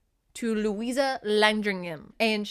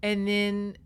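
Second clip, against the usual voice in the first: ordinary talk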